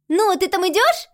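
put-on high, loud impatient girl; hurrying. A narrator's reading. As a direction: rush her along. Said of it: "поторопила меня".

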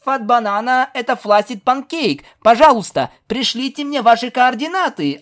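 high, urgent pretend soldier on the radio; play radio call. Pegasus answering from behind the trash can, radio play. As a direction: call a lost comrade over the radio in play. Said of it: "откликнулась Скуталу с другой стороны".

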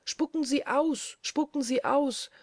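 high-pitched insistent call; urgent high repeated call; crying out. A narrator's reading. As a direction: cry the warning out high, without a full shout. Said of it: "rief dabei die Frau Bause immer noch".

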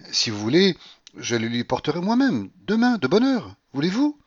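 excited, rising to a high plea; eager offer. A narrator's reading. Narrator pleads eagerly with Paulina.

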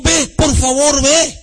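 loud, high urgent plea; súplica insistente. Acted. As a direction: plead with them to go, drawn out and forceful. Voicing people urging toward God.

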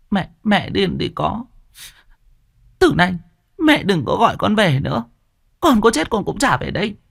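high, hurt daughter voice after crying; a young girl's high, wounded retort; tearful daughter. A narrator's reading. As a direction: fling the words back through tears. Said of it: "Con Điệp ôm mặt khóc".

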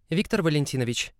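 lightly voiced girl speaking up; pleading young woman. A narrator's reading. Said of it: "заступилась за меня худенькая девушка".

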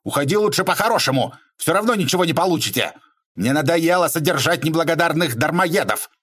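high, rapid outburst; angry squeal. A narrator's reading. Man ordering the spongers out, high angry squeal. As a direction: rattle it out fast in a high, angry voice. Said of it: "перешёл на визг и Бобров".

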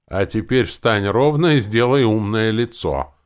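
loud, mildly wheezy order; wheezing. A narrator's reading. Zombie Valera instructing his dark lord, wheezing. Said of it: "просипел зомби Валера".

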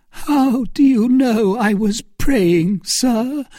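high, slow tearful protest; voice broken by sobs. A narrator's reading. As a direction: answer as if through sobs, pitched high. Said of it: "in a voice broken by sobs".

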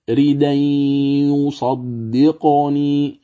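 slow drawn-out "ريدا يصدقني" with nasal merging; Warsh recitation. Shown as a demonstration. Teacher demonstrates the Warsh reading, slow recitation. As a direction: recite "ريدا يصدقني" slowly, n merged into y with ghunna. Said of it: "هكذا تقرأ".

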